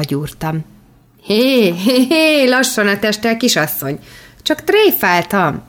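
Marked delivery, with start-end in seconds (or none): 1.2-5.6 mild mock alarm, hands up; calling out. A narrator's reading. Daniel dodging the snowball, calling out. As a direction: back off laughingly from a snowball. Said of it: "kiáltott fel".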